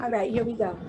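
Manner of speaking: usual speaking voice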